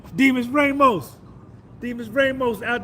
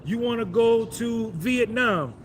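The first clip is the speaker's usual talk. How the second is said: ordinary talk, nothing marked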